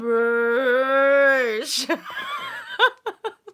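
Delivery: long, high, loud laughing fit; giddy laugh. Real, not acted. Vlogger overcome with giddiness, long high laugh. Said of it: "I've got the giddy giddies".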